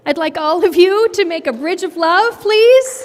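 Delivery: loud, high cheerful call. Real, not acted. Teacher calls loudly to the kids to make a bridge of love. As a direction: call the whole class to form a bridge.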